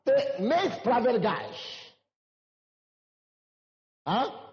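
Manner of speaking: normal talking voice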